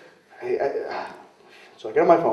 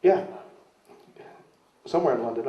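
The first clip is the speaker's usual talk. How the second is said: normal talking voice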